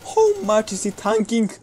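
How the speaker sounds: high, loud incredulous cry; disbelief. Real, not acted. Gamer yelling in disbelief at a bullet-sponge enemy. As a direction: shout up in disbelief at the tanky enemy. Shooter tanking bullets in game.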